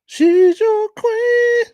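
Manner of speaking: very high and slow, stretched out; sing-song it up high and slow; high drawn-out hype. Acted. Hype about the woman, very high.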